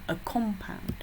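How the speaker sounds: "compound" as a noun, stressed on COM; first syllable of "compound" strong, POUND falling away; COM-pound, careful teacher's demonstration. Shown as a demonstration. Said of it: "Donc faites attention".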